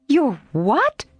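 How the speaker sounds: high, sharp surprised question; startled cry. A narrator's reading. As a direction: Jo can't believe her ears. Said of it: "cried Joe".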